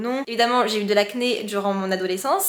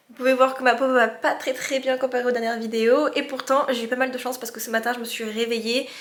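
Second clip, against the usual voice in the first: normal talking voice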